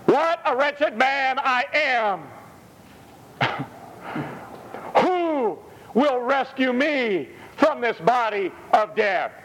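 high, slow cry of a wretched man; exclaimed lament. Retold or imitated. Preacher voicing Paul's cry to the congregation, high slow lament. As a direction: cry it out like Paul in despair. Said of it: "with an exclamation point, says".

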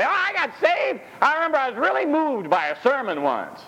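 mocking a churchgoer's shallow testimony, lightly put on; mock churchy gush. Preacher mocking a shallow churchgoer's testimony, mildly gushing. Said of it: "I can't even talk like that".

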